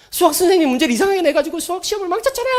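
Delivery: put-on high, fast whining teenage son; whiny son. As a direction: rush out the complaint like a sulking teen. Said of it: "완전 망쳤다는 거예요". (높고 빠르게 투덜대는 아들 흉내)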